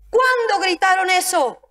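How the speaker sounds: usual speaking voice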